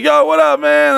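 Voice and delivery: imitated eager hello, pitch leaping up; high-pitched greeting. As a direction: hail a homie way up high. Acted greeting to supposed homie.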